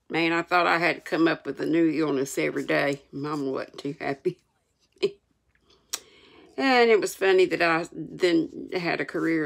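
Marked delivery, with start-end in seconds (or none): none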